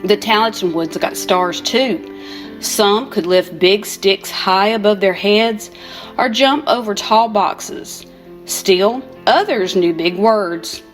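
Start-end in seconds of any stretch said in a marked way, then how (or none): none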